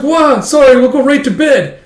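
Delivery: high, loud mock panic; startled apology. Boys caught up late, startled mock apology. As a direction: blurt it as if caught out.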